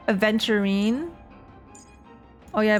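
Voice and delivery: ordinary talk, nothing marked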